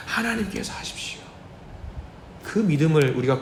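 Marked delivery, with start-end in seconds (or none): 0.1-1.2 breathy whisper of surrender; hushed whisper to God; whispered prayer. Shown as a demonstration. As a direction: whisper it, giving it all to God. Repeated prayer now whispered.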